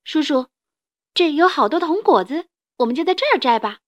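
put-on high bright child calling out; a small child hailing a grown-up; little boy voice. Little dinosaur greets the stranger.

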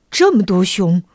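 low, sighing rabbit. A narrator's reading. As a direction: say it low, with a sigh. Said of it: "叹着气说". (低沉地叹着气说)

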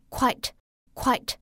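quite slowly as one syllable, no extra vowel. Shown as a demonstration. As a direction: draw out quite as a single beat. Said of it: "读作quite". (慢读quite，一个音节)

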